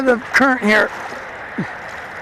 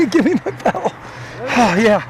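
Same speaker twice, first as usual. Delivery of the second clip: normal talking voice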